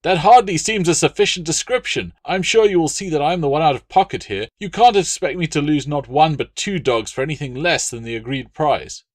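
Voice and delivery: high, loud aggrieved protest; flustered dealer demanding his price; indignant merchant. Acted. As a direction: argue your losses hotly. Appius angry, out of pocket.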